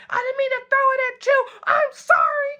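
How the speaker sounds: high squeaky apologizing voice; mock apology. Friend apologizing after throwing juice, high and frantic.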